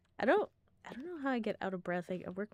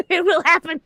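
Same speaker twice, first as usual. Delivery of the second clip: loud determined yell; shouting; 8 semitones higher, 19 dB louder than usual. Real, not acted. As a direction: yell it with conviction.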